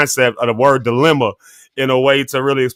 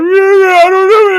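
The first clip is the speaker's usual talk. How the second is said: put-on much higher, louder kid voice; young me, lost; 6 dB louder than usual. Little boy answering mama about the dilemma, high and confused. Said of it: "I'm like".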